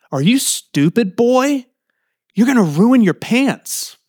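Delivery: retold snap from an uncle, pitched high; snapped scolding. Uncle to boy on a tree stump, retold snapping. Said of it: "my uncle snapped".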